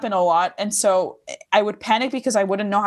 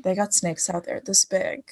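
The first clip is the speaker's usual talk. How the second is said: soft, tender declaration; gentle, quieter affection; loving; 4 dB quieter than usual. Shown as a demonstration. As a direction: say it like telling someone you love them. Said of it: "tell someone I love them".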